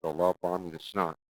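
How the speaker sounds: normal talking voice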